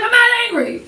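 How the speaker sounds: very high squeaky protest; defensive denial. Acted. Answering friends who say she looks angry. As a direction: protest much too high.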